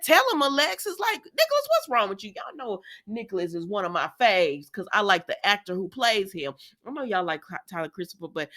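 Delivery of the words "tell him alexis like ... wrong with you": egging on. Retold or imitated. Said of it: "And I said".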